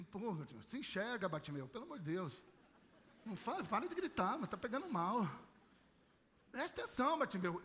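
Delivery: embarrassed hushing. Retold or imitated. Other blind man hushing Bartimeu, embarrassed and quiet. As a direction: hush your friend, ashamed of the scene. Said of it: "a vergonha do outro cego".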